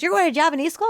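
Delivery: high disbelieving question; surprised old neighbor. Retold or imitated. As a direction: ask it in lightly voiced disbelief.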